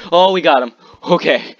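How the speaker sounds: high, loud burst of triumph; thrilled relief. Real, not acted. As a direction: exclaim the win with voice jumping up. Celebrates winning the fight.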